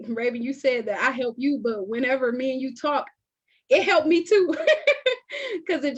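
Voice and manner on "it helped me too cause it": high, laughing admission. Real, not acted. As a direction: break into a laugh as you finish. Warmly laughing at mutual help.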